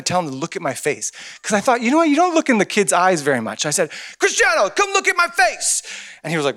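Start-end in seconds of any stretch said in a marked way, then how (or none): 4.2-5.8 accented mock yell. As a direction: yell it like a cheering fan, accent and all. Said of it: "I'm gonna yell".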